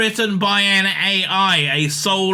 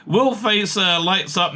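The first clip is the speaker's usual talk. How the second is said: usual speaking voice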